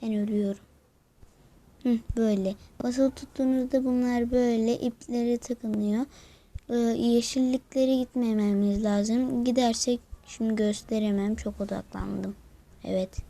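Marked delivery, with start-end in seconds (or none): none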